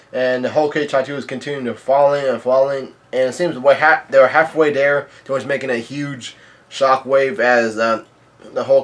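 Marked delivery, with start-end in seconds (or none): none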